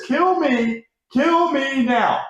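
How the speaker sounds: high, drawn-out mock plea; mock despair. Fan reading out his own message, high mock despair. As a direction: stretch it into a high, hammed-up wail.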